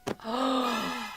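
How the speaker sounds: long theatrical gasp; shocked gasp. Pretend. Player seeing Mitchell killed, shocked gasp. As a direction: suck in a horrified breath.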